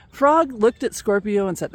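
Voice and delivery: ordinary delivery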